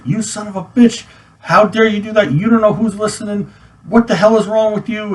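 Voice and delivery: high-pitched imitation of a cursing rant; retold angry screaming. Sergeant cursing out a soldier over a phone call, retold screaming. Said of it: "he starts cursing at me, screaming".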